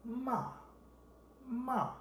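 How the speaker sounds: "骂" short, started high and dropped; falling fourth tone. Shown as a demonstration. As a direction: drop "骂" sharply from a high pitch. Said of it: "The last one is falling tone".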